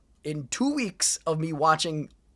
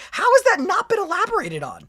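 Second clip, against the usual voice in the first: higher, exasperated rising question; exasperated disbelief; 10 semitones higher than usual. Real, not acted. Asking the comments, in exasperated disbelief. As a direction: demand an answer in disbelief.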